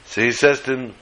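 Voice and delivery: normal talking voice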